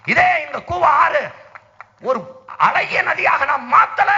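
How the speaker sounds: loud and high, shouting the river's name to the crowd; fiery rally orator's shout. Real, not acted. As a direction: throw it out over the crowd at full voice.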